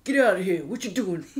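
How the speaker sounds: put-on New York accent; American accent, New York. Shown as a demonstration. Showing friends an American accent, put-on New York accent. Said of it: "my accent for American would be".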